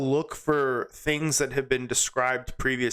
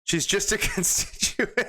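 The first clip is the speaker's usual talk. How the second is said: higher voice breaking into laughter; laughing; 7 semitones higher than usual. Real, not acted. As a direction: crack up halfway through the line. Cracks up reading the joke.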